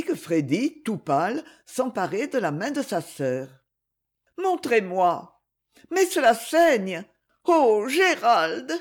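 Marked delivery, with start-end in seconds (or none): 4.4-8.7 slow, raised cries of distress; anxious, drawn-out exclamations; shocked dismay. A narrator's reading. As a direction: draw out the horrified reproach. Isabelle sees the bleeding, reproaches Gérald.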